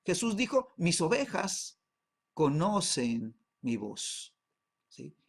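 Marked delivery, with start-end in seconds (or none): 0.8-4.4 solemn slow quote. Retold or imitated. Quoting Jesus in sermon.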